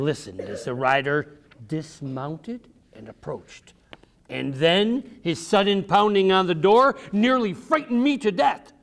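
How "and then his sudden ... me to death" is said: plain reading voice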